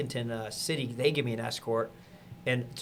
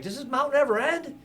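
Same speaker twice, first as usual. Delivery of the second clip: yelled; 13 semitones higher than usual. Retold or imitated. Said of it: "I just yelled over to 'em".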